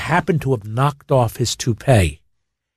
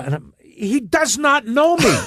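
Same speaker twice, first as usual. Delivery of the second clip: usual speaking voice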